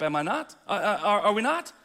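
usual speaking voice

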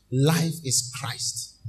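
drawn-out, weighty answer; slow refrain. Acted. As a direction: let it sink in slowly. Slow refrain after call.

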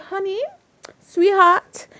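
high, syrupy mock endearment; mock sweet talk. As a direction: coo it up high like a lovestruck fake. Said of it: "He didn't say it like that".

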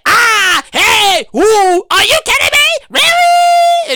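high, loud hyped-up exclamations; all excited. Retold or imitated. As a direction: re-enact getting worked up, climbing to a squeal. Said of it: "I get all excited".

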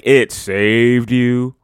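long, stretched-out mocking repeat; incredulous, slowed-down echo of a grand claim; mock disbelief. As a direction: drag the words out skeptically.

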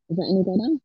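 muffled, churning garbled line; washing machine sound. Real, not acted. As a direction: voice swirling through a covered mic. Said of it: "you sound like you're in a washing machine".

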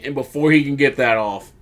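usual speaking voice

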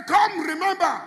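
high, loud call; call to repeat. Shown as a demonstration. Preacher leading the congregation in a loud high call. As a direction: cry it out for the congregation to echo.

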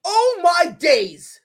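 slow, high, loud amazed shout. Real, not acted. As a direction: stretch it out in awe. Amazed by the shot.

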